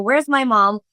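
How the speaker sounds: usual speaking voice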